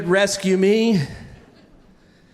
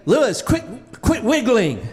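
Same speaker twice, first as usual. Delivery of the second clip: higher, urgent mock yell; mock coach yell; 3 semitones higher than usual. Mean coach yelling at a wiggly kid in swim practice, mock yell. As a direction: yell like an exasperated coach, half-strength. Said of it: "he would yell at me".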